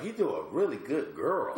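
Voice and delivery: put-on high girly voice; lightly voiced woman; girl voice. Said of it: "a great girl impression".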